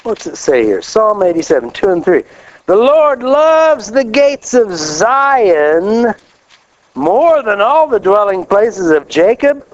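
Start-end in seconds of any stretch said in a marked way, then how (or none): 2.7-9.7 scripture reading. Acted. Reads scripture slowly, raised.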